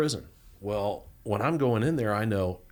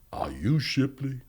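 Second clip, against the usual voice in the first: lightly voiced big man, slowly questioning; slow, drawn-out imitation of a big inmate; huge inmate impression; 3 semitones higher, slower than usual. Big to new inmate, slow imitated question. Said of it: "And he looks at me. He goes".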